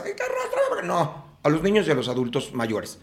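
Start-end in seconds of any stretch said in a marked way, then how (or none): 0.1-0.9 laughing high mock whine; mocking a lazy whiner. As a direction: squeak it out mockingly through a laugh. Mocking someone who wants dragging.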